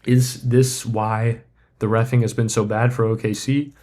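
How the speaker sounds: ordinary delivery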